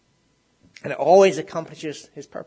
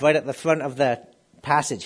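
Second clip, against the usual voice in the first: ordinary talk, nothing marked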